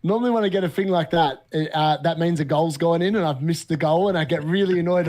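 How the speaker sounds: ordinary talk, nothing marked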